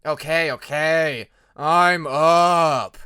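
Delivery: loud, slow, annoyed protest; grumpy shout. A narrator's reading. Jaguar woken early, shouting grumpily from the cave. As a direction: yell back at the waking gong. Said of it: "made his dislike of the noise well known".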